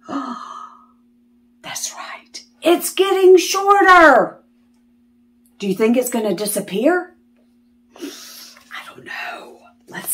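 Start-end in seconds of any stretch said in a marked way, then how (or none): 0.1-2.4 put-on hushed whisper to the children; soft whispered aside. A narrator's reading. 8.0-10.1 hushed, slow whisper; secretive slow whisper. A narrator's reading. Suspense for children.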